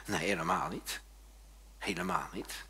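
low, hushed correction; quiet denial. Real, not acted. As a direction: drop the voice and deny it gently. Quiet denial after mocking striving.

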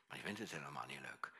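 put-on soft, low whisper of a sad child. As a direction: hush it like a hurting child's secret. Hurt child confiding her sadness.

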